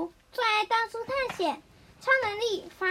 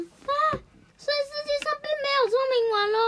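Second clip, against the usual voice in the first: 失望的小孩; 8 semitones higher, faster than usual. Acted. 绿豆冰失望.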